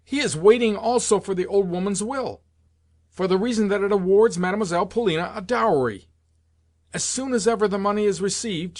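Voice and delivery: high-pitched, agitated tirade; impassioned, indignant outpouring; furious rant. A narrator's reading. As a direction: rail on heatedly, pitch riding high. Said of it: "I shouted in my fury".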